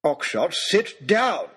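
high, slow, drawn-out order; stretched-out furious command; angry roar. A narrator's reading. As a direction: roar it as an exasperated lord. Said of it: "roared Lord Daunt".

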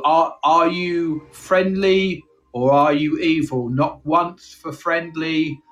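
slow, solemn spirit-calling voice; séance medium voice. Pretend. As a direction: summon the dark spirits slowly.

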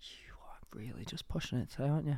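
soft mock-menacing whisper. Real, not acted. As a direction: hiss it under your breath. Teasing friend's rude joke.

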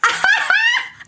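high, loud burst of laughter; real delighted laugh; surprised laugh. Real, not acted. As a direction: caught off guard, laugh out. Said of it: "you have done your research".

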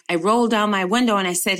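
normal talking voice

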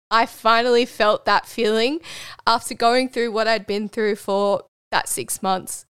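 usual speaking voice

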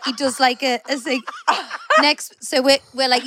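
normal talking voice

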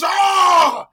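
huge high-pitched yell; Al Pacino. Retold or imitated. Podcaster mimics actor finally erupting, full-volume yell. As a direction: explode into a long hammed-up bellow. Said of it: "it's like he's gonna yell".

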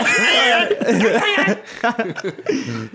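extended laugh, pitched high; long high laugh. Real, not acted. Laughing at a bad baby Tony Montana voice, high. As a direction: let the laugh roll on.

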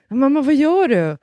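put-on high, loud little-girl voice; mock child asking mom; child's shocked question. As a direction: play the startled daughter. Child voiced in retold story.

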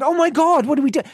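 high-pitched, hammed-up alarm; retold panic. Producer who just lost the main host, acted high-pitched panic. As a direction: re-enact frantic dismay, voice leaping high.

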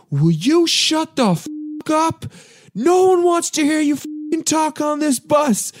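high furious yell at the rowdy guys; mock the angry passenger telling them to shut up; angry drunk yelling. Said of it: "turn around and go".

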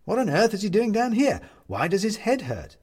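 usual speaking voice

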